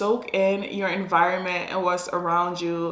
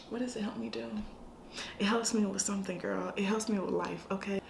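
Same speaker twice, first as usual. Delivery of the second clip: quiet aside; 11 dB quieter, faster than usual. Acted. Answering herself in an aside voice.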